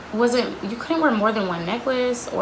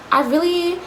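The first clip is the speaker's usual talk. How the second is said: higher, sugary talking-down voice; condescending manager; 8 semitones higher than usual. Retold or imitated. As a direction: mimic a boss talking to a child. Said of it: "she always talked to you really condescending".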